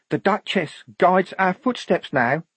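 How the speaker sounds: high, otherworldly believer; devout certainty. Acted. Devout recruit taking the telescope, reverently certain. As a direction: proclaim it with total faith.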